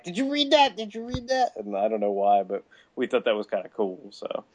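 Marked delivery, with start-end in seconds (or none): 0.0-1.5 high-pitched imitation of eager fans; hammed-up fanboy amazement; excited friends. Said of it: "We're like".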